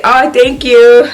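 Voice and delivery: drawn-out coy thank you. Retold or imitated. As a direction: thank him sweetly. Thanking the bottle sender.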